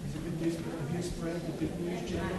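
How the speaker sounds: softly asking if the good news is spreading; low hush, like passing on a secret. Acted. Children's talk leader to children, hushed.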